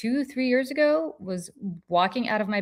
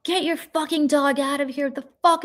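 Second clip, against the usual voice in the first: higher, hostile retold outburst; retold screaming; 8 semitones higher than usual. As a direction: bark at her to get the dog away. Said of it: "starts screaming at me going".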